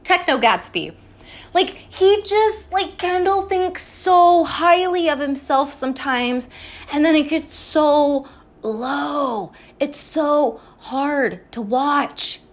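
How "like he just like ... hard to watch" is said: 1 drawn out. Real, not acted. Pained emphatic commentary on Kendall.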